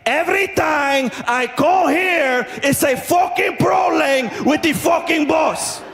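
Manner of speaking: high, slow, heavily accented mock rant; Spanish guy losing it. Spanish man raging because the bus isn't coming, losing it. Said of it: "this guy lost it".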